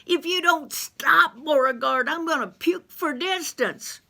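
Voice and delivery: high, loud, desperate holler; panicked high-pitched yelling. Acted. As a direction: yell up out of the pit for rescue.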